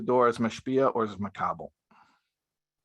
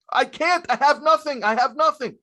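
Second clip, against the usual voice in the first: high, loud, pleading whine; exhausted husband's protest; 6 dB louder than usual. Retold or imitated. Drained husband protesting he has nothing, high whine. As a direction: cry out that you're empty.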